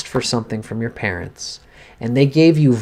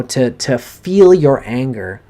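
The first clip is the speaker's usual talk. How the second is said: ordinary talk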